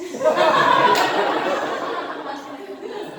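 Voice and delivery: loud, high burst of laughter; real loud laughter; big laugh. Real, not acted. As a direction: crack up at the joke. Laughs at own punchline.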